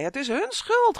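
very high, loud screech; shrieking blame. A narrator's reading. Olivia circling past the humans, screeching. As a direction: shriek the accusation. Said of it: "gilde ze".

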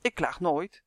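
usual speaking voice

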